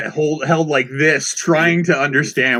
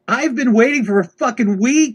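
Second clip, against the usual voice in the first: mock angry yell; 10 semitones higher than usual. Customer yelling at the delivery guy, exasperated and high. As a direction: fling it back, exasperated.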